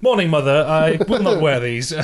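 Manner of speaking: high, loud, grand announcement; comic newborn voice. Pretend. Newborn refusing dungarees to mother, comic high voice. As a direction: make a pompous little entrance as a talking newborn.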